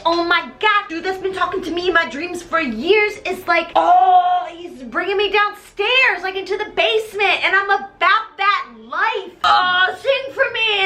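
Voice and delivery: high-pitched entranced gushing; hammed-up breakneck swoon of a smitten fan; Christine. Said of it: "She's like".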